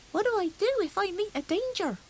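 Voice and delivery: put-on high, eager small boy's boast; a small hedgehog bragging to his cousins; little hedgehog voice. Little hedgehog boasting to his cousins, high and eager. Fuzzy Peg asks mother.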